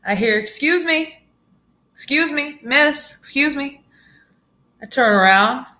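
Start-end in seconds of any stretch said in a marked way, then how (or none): 0.4-3.8 higher-pitched polite "excuse me"; calling out. Retold or imitated. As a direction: imitate someone trying to get a stranger's attention. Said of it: "He's calling".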